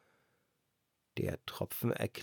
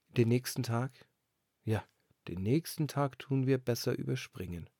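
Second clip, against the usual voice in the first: ordinary delivery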